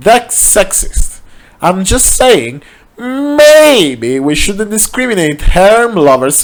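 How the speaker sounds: a lightly voiced prissy objection; indignant protest. A narrator's reading. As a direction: act offended on principle.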